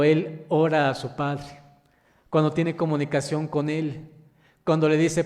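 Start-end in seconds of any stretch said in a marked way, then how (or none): none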